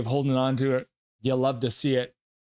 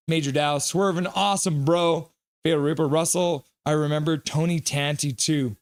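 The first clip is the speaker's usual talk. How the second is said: normal talking voice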